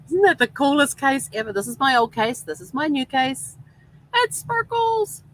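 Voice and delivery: thrilled squeal about the new case; excited. Real, not acted. Showing off her new phone case.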